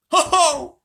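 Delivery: loud mocking ho ho; scornful high laugh. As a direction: scoff out a big laugh. Miraz scoffing.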